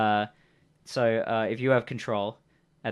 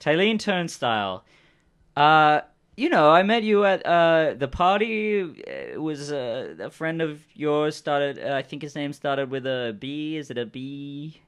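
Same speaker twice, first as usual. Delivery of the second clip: higher-pitched, halting character voice; bluffing stranger; 5 semitones higher, 4 dB louder, slower than usual. Acted. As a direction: stumble through a made-up story. Game master voices improvising stranger.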